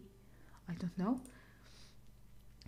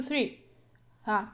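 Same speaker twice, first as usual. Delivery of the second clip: usual speaking voice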